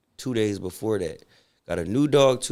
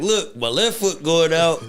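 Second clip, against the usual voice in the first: high, slow dog voice narrating its decline; voicing old dying dog; 7 semitones higher, 4 dB louder than usual. Sparky the dying dog telling owner his foot is going, high and slow. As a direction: talk like a feeble old dog checking out.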